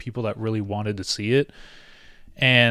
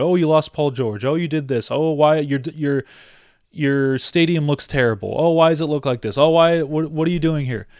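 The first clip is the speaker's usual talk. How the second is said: higher, louder mock taunting of Clippers fans; mocking critics; 4 semitones higher, 4 dB louder than usual. Fan voicing haters who mock the Clippers, mock taunting. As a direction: sneer it like a trash-talking rival fan.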